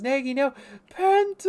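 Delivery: high, loud mock cry of alarm, rising to a yelp on Penta. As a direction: yell out a warning to a friend. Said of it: "He goes".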